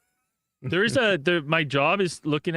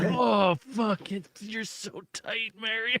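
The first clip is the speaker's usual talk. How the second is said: higher, breathy slow moan; mock moaning; 6 semitones higher, much slower than usual. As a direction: ham up a bedroom moan, slowly. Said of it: "And he goes".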